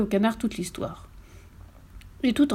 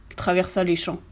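plain reading voice

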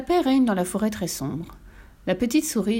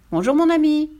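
higher, louder cheery hello; bright greeting; 8 semitones higher, 5 dB louder than usual. A narrator's reading. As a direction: call out a cheeky hello. Mouse greets the owl.

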